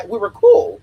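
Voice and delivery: sudden high, loud insistence; emphatic exclaim. Real, not acted. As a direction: burst out with it. Insists they were friends.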